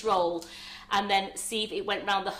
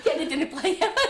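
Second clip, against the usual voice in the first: laughing; 12 semitones higher, 6 dB louder than usual. Real, not acted. Joking about dinner plates.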